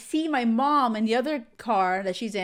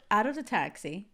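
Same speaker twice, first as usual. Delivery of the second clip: usual speaking voice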